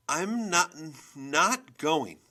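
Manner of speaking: loud, high, halting refusal; defiant outburst. A narrator's reading. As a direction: blurt out your refusal. Girl finally refuses mother.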